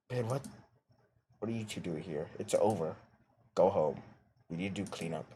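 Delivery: a tired guy shooing them home; tired Ben. Acted. Ben voiced while reading.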